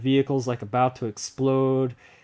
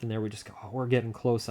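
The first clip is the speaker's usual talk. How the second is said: usual speaking voice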